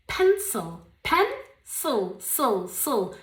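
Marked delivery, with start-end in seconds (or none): 2.2-3.1 sil said with little mouth opening; small-mouth sil. Shown as a demonstration. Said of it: "No abro mucho la boca".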